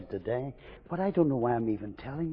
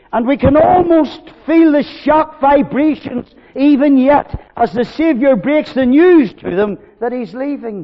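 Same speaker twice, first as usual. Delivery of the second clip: loud, high, ringing preaching; preaching at full force; 13 semitones higher, 13 dB louder than usual. Acted. Preacher pressing his reasons on the congregation, full force. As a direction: drive each reason home to the hall.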